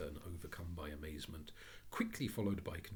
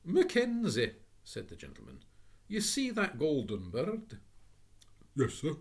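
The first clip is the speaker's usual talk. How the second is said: higher, louder call; summoning porter; 8 semitones higher, 8 dB louder than usual. Acted. Gentleman calls porter.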